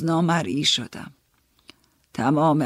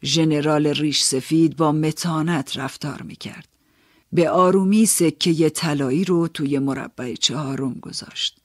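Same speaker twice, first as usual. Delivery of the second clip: ordinary delivery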